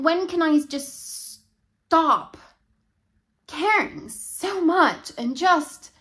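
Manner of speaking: loud, high, slow, drawn-out plea; overwhelmed plea. Acted. Worn out from helping everyone, loud pleading. Overloaded speaker pleads for relief.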